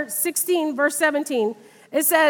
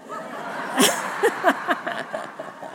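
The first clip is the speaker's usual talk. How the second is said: breathy silent laugh; 2 semitones higher, 8 dB quieter than usual. Real, not acted. Laughs at her own story.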